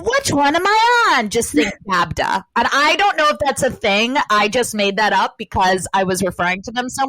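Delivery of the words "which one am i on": high-pitched mock puzzled question; imagined wondering person. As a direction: act out someone suddenly unsure, pitched up. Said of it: "if you're ever like".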